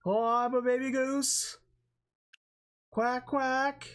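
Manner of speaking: high, slow silly goose voice; squeaky baby goose quacking. Pretend. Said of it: "somebody with a fucking goose onesie".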